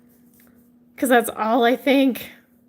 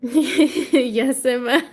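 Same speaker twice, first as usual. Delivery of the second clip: real laughter around the words; laughing; 4 semitones higher, much slower than usual. Real, not acted. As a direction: answer while laughing. Laughing at a chat comment.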